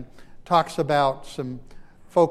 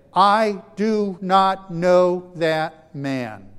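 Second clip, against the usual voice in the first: every word slow, loud and hammered; emphatic denial; 3 semitones higher, 7 dB louder, much slower than usual. Acted. Peter swearing to accusers he never knew Jesus, slow hammered denial. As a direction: underline each word, very slowly. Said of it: "he's emphasizing and he's underlining it".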